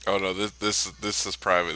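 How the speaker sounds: ordinary talk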